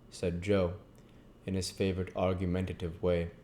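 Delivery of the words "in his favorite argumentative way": usual speaking voice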